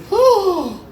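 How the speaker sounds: very high, drawn-out mock shock; shocked gasp. Mom seeing the unpacked package, high shocked cry. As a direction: imitate a horrified mother. Mom's shocked reaction acted out.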